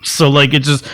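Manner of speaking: usual speaking voice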